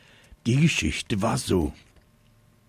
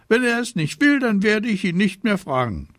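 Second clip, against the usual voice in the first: put-on high, loud little-girl protest; high-voiced child justifying herself; defensive sister; 12 semitones higher, 5 dB louder, faster than usual. A narrator's reading. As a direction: pipe up in self-defence.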